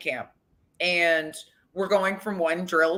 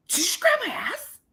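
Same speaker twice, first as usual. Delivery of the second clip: much higher, incredulous challenge; mock indignant. She confronts the player who walked by, pitched-up disbelief. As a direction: call him out in disbelief.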